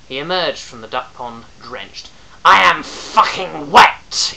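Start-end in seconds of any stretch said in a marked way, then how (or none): 2.4-4.3 slow, high, loud furious scream; an outraged howl. Acted. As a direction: bellow it, dripping with rage. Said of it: "he screamed".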